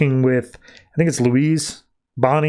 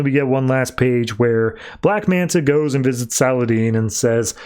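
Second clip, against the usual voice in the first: normal talking voice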